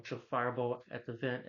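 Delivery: usual speaking voice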